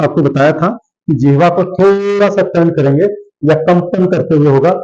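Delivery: usual speaking voice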